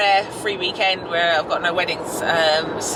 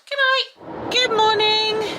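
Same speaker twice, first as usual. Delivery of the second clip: high, slow drawn-out good morning; sing-song greeting; 13 semitones higher, much slower than usual. Acted. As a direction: stretch the greeting out brightly.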